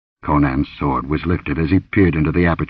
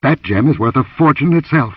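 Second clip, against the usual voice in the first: raised, urgent order; commanding; 8 semitones higher, 4 dB louder than usual. A narrator's reading. Warrior orders the dancer to fetch the gem, commanding. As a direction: send her off impatiently. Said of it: "he commanded".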